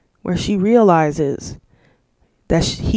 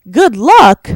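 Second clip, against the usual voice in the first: loud, high, disbelieving GOOD LUCK; GOOD LUCK pitched up loud, appalled; incredulous echo; 6 semitones higher, 9 dB louder than usual. Retold or imitated. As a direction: throw it back in sheer disbelief. Incredulously echoes their goodbye.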